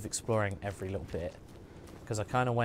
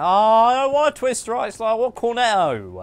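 mock whiny kid; 10 semitones higher, 12 dB louder than usual. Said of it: "and you're like".

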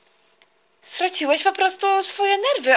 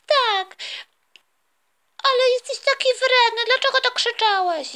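put-on tearful child; 8 semitones higher than usual. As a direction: cry it out like a hurt kid. Daughter accuses dad.

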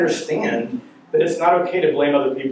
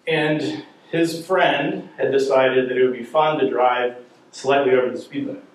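ordinary talk, nothing marked

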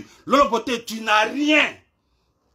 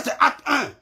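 ordinary delivery

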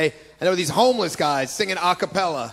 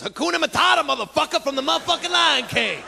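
street guy; 6 semitones higher than usual. Retold or imitated. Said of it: "One guy looks at the other guy and goes".

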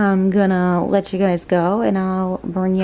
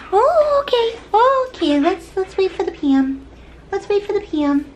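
much higher sing-song voice to the dog; pet talk. Real, not acted. Owner telling her dog to wait for the PM, high pet talk.

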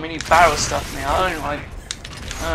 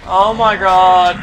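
loud exasperated groan; 9 dB louder, slower than usual. Real, not acted. Exasperated during fight.

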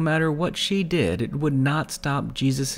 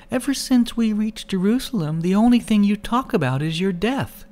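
higher, pleading woman's voice; anxious young woman pressing a question; Mary, upset; 8 semitones higher than usual. A narrator's reading. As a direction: plead with him, voice pitched up and hurt.

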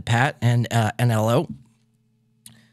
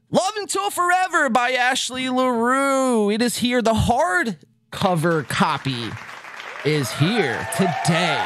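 excited announcement; 5 semitones higher than usual. Real, not acted. Excited book arrival announcement.

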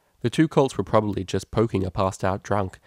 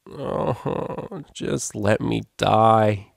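long, drawn-out groaning plea; groaned; much slower than usual. A narrator's reading. Hungover unicorn roused on the pavement, groaning. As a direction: groan it slowly, woken and suffering. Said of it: "groaned the unicorn".

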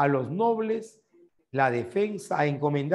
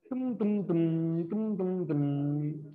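mouth drumroll; 4 dB quieter, much slower than usual. Pretend. Drumroll before the quiz answer.

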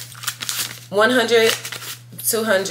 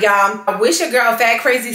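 ordinary talk, nothing marked

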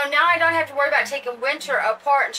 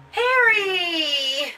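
playful reveal; 4 semitones higher than usual. Acted. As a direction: announce the name with a gleeful lift. Announcing the doll's name.

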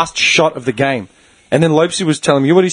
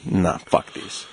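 low slow muttered; 10 semitones lower, 9 dB quieter, much slower than usual. Retold or imitated. Cracking it at supervisor.

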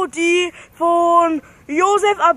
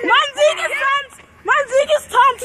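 excited shout; 7 semitones higher than usual. Real, not acted. Celebrating victory with a dance.

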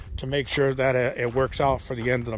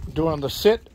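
usual speaking voice

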